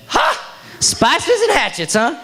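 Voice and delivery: mock laugh. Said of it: "And she went".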